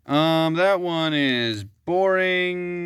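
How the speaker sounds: slow, drawn-out bored voice; bored put-on voice. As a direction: drag it out, unimpressed. Dismissing review in odd voice.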